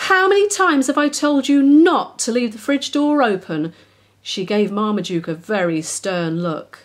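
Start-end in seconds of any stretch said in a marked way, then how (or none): 0.1-3.7 louder, higher scolding mum; loud cross mum. A narrator's reading. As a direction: scold the child for the mess. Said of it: "She turned to Billy".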